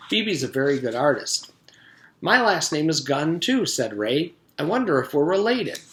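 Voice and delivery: lively read-aloud storytelling voice; story narration. A narrator's reading. Teacher reading a chapter to the class, lively storytelling.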